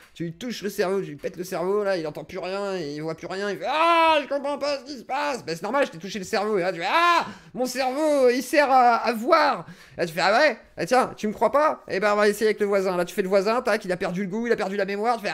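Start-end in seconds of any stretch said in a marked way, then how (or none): 3.7-5.4 high, loud panicked confusion; mock bewildered cry. Brain-poked victim acting confused. 6.9-9.7 loud, high, slow amazed realization; mock revelation. Mock discovery of brain's purpose. 10.2-13.0 loud, high cocky dare; mock challenge. Taunting the doubting friend.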